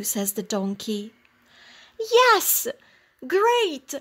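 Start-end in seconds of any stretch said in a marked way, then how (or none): 2.0-3.9 high, eager rooster voice; excited rooster raring to go; delighted rooster. A narrator's reading. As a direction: cheer it out, much higher and louder. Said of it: "The rooster is happy now".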